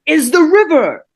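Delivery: hammering the maxim home, drawn out; exasperated teacher. Retold or imitated. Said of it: "it's the same fucking thing".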